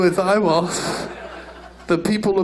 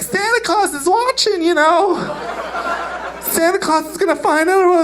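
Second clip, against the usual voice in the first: much higher sing-song mom voice; diplomatic mother; 11 semitones higher than usual. Retold or imitated. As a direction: coax the kid like a fake-sweet mother. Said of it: "The mother's trying to be all diplomatic".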